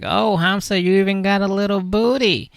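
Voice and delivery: put-on high girlish voice. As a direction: coo it like a smitten girlfriend. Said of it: "she's like".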